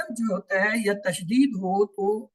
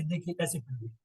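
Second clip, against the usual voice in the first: whisper; 4 semitones lower, 9 dB quieter, faster than usual. Real, not acted. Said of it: "whispered aside to class".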